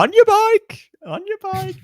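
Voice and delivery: very high-pitched put-on catchphrase voice; Tony G impression. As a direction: mimic a poker personality's taunt. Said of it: "Tony G's my goat".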